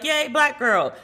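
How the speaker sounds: high, bright mock cheer of joy; little excited cheer. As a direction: squeal it like a happy fan. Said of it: "And I was like".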